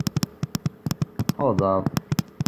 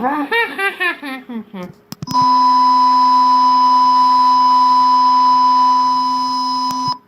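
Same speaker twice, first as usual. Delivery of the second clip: high shrieking laugh; 7 dB louder than usual. Real, not acted. Laughing hard at game sound.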